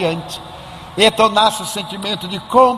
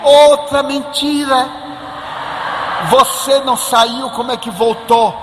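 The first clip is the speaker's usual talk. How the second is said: put-on outraged child yelling at his uncle; child's shout; 7 semitones higher than usual. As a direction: yell it like a kid with hands on hips. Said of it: "e gritou".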